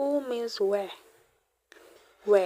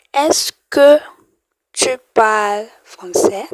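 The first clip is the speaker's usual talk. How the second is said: emphatic demo; 12 dB louder than usual. Shown as a demonstration. Teacher loudly models the question for students. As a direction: announce the question form boldly. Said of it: "I just add est-ce que".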